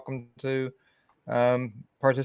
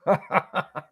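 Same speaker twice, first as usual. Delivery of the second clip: short laugh; 7 semitones higher than usual. Real, not acted. Laughing at Cindy joke.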